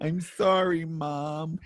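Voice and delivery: hammed-up child begging mom; mock apology.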